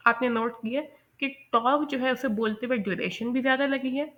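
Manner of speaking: usual speaking voice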